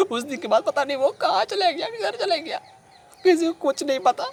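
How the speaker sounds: very high, distraught wail of words; grieving lament. Acted. Telling sahib the friend vanished, grieving lament. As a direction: lament helplessly, pitched high. Acted grief at friend's disappearance.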